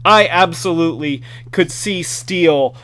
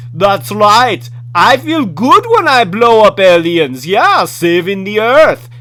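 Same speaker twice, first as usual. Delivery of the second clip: hammed-up Austrian action-hero accent, louder and higher; bad Austrian accent; 4 semitones higher, 6 dB louder than usual. Toy reviewer voices his figure in a bad Austrian accent. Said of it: "my really bad impression of his Austrian accent".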